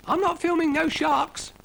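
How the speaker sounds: very high squeaky refusal; snail Brian refusing. Acted. Brian the snail refusing to film sharks, very high. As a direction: dig your heels in, high. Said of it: "said Brian".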